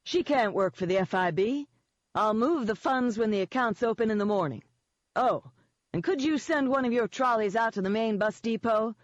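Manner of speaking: smug Eden. Acted. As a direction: needle him with mock offense and easy confidence.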